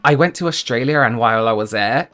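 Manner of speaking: "Australia" run into "and" with an added r sound; linked intrusive R. Shown as a demonstration. Showing viewers how English people link words, with an r. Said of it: "you would say".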